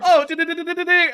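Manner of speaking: squeaky mock stutter on de- de- dear; high stuttered oh dear. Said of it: "why is my voice getting so high-pitched".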